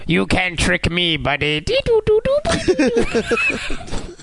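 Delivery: toy robot voice. Pretend. Robot Picard speaks.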